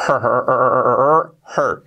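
"Her" with breathed h and held r; aspirated H, English R. Shown as a demonstration. Teacher drilling "Her", aspirated h and English r. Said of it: "La H aspirada y la".

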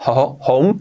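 home with the h breathed out like a j sound; aspirated h. Shown as a demonstration. Teacher to Spanish learners, home with a breathy h. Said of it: "lo mismo la H".

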